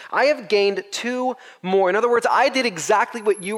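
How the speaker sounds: high, slow, eager report; proud to show the master; mildly excited servant. Retold or imitated. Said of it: "the same anticipation".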